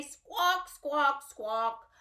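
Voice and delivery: high squawk dropping each time; parrot squawk. Pretend. Teacher squawking like a parrot in the song. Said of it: "The parrots in the jungle say".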